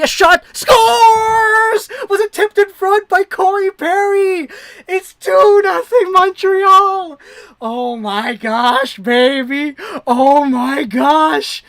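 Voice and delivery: full-throated slow roar of a goal; goal call. Real, not acted. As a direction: erupt as the puck goes in. Goal call, team scores.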